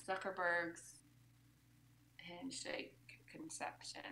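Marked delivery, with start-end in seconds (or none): 2.2-4.0 whispered. Real, not acted. Whispered title suggestion.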